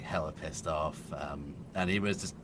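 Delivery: ordinary talk, nothing marked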